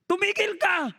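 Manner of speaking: high-pitched mock plea to stop; lion's protest. Preacher voices the grabbed lion telling the man to stop, protesting. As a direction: protest like a cornered man in costume. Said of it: "Tapos sabi ng leon".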